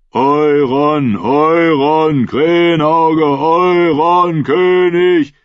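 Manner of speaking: drawn chant rising in pitch; swelling roar. A narrator's reading. Crowd acclaims Euron as king, chant swelling into a roar. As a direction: build the chant toward a roar. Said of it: "wurde zum Brüllen".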